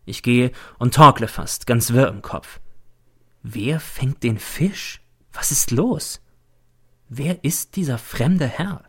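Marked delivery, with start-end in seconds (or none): none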